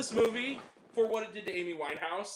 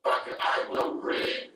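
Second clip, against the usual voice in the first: breathy hissed curses, very slow at the end; hammed-up wrath promised to the filmmakers; mock rage; 5 semitones higher, slower than usual. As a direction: seethe like a vengeful demon swearing revenge. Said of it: "give you my reaction".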